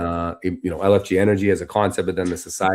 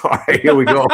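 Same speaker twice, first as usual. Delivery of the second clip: laughing, higher and louder; 6 semitones higher, 8 dB louder than usual. Retold or imitated. Laughing to himself after bluffing the event team. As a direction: chuckle through it, amazed at the bluff.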